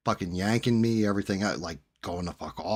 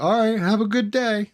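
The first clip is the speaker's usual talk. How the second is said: much higher, louder meek mock voice; imitating the guy; 12 semitones higher, 5 dB louder than usual. Mimicking the guy backing down, much higher voice. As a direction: parody his sudden politeness. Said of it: "he was just like".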